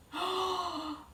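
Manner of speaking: high shocked intake of breath; sharp gasp. Acted. Storyteller acting the brothers' shock, sharp gasp. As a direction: gasp in horror. Cup found in Benjamin's bag.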